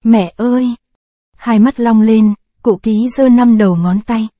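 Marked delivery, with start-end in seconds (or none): 0.0-0.7 soft whimpering cry. A narrator's reading. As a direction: whimper it, half-choked with grief. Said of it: "chị ti tỉ". (khẽ nức nở gọi mẹ)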